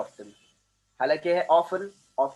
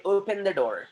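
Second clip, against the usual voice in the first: American accent; 4 semitones higher, much slower than usual. Shown as a demonstration. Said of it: "American लोग बोलते हैं".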